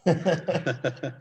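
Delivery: brief high chuckle; short amused laugh. Real, not acted. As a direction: laugh lightly at the joke. Laughs at the host's joke.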